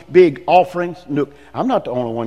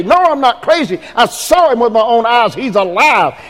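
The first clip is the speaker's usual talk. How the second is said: higher, insisting with excitement; insistent witness; 7 semitones higher, 4 dB louder than usual. Acted. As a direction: swear you saw him yourself. Disciple insisting he saw him.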